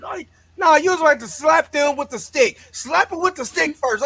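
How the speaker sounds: loud, high yell at the screen; real loud outburst; loud heckling. Real, not acted. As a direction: shout advice at the characters. Heckling the movie.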